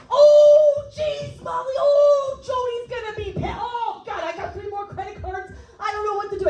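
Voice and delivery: mock-panicked high voice, opening on a yell; Tommy Neptune. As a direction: imitate a tour manager in a flap. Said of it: "it's more of a yelling".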